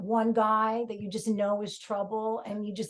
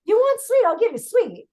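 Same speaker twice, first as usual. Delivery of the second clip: mock threat; 9 semitones higher, 7 dB louder than usual.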